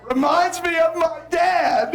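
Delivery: slow, laughing mock shout; hammed-up accusing shout, laughing; laughing tease. Shown as a demonstration. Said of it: "you can get very aggressive here".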